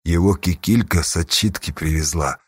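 low, dull explanation; muffled, hollow. A narrator's reading. Old Yakim explains the captive to his leader, muffled. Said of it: "глухо пояснил Яким".

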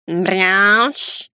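drawn-out meow-like creature noise; cat's proclamation. Acted. Ghostly cat announcing itself, yowling. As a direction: yowl it out like a big cat. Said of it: "провозгласил котик".

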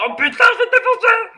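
high, loud joking threat; mock furious yell. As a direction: yell a hammed-up threat with a laugh. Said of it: "ils vont dire".

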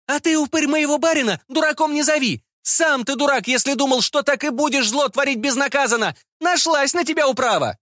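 very high-pitched woman's angry cry; crying out defiantly. A narrator's reading. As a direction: yell an accusation in his face, pitched high. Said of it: "она крикнула в лицо".

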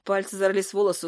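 plain reading voice

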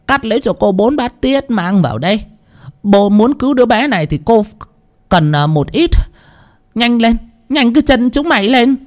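high, commanding woman's voice; stern medium. Acted. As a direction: hurry them with sharp orders. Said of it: "nghiêm giọng nói".

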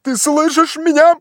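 very high, loud question; high, insistent calling; urgent shout. A narrator's reading. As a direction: holler up to someone in a treetop. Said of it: "закричал он".

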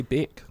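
"beck" with a short e vowel; mispronounced vowel. Shown as a demonstration. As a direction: say "beck" as a failed British attempt. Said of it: "I just wanna say".

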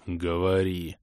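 low-key croaking knight; strained crusader. A narrator's reading. Crusader letting the thief speak, strained croak. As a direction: croak out a short order.

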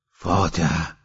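subdued, slightly choked announcement. A narrator's reading. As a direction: say it with a touch of a lump in the throat. (با صدای کمی گرفته)

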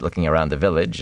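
ordinary delivery, nothing marked

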